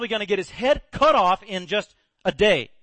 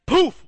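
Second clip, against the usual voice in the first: much higher, louder poof; poof effect; 10 semitones higher, 10 dB louder than usual. Retold or imitated. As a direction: burst out the magic sound. Miracle sound effect.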